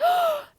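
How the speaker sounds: sharp high startled gasp. A narrator's reading. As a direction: gasp at the sight. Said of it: "Belle gasped".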